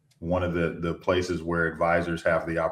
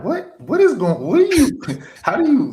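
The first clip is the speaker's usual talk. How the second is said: high, laughing disbelief, voice jumping up; high incredulous laughing what; 6 dB louder than usual. Retold or imitated. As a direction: gasp out in laughing disbelief at bad advisors. Incredulous at other advisors.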